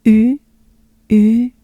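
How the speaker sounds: punched French U, one at a time; French u demo. Shown as a demonstration. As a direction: model U as the French vowel. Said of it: "let's do".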